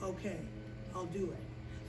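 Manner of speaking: very soft, low surrender; small, resigned acted voice; reluctant giving in. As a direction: agree meekly, almost under your breath. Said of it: "this time Moses said".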